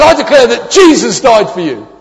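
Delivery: loud ringing proclamation; bold declaration. Retold or imitated. Friend declaring boldly to the customs officer, loud and proud.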